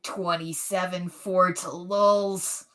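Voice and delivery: very slow, drawn-out tough-guy voice; a young man's try at a Clint Eastwood voice. Pretend. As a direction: stretch every word out like an old gunslinger. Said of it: "do Clint Eastwood".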